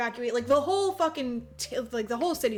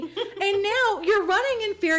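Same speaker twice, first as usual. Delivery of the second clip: higher, louder aggrieved monster rant; mock angry rant; 9 semitones higher, 4 dB louder than usual. Lizard rants at America, mock angry and high. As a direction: vent like an offended giant.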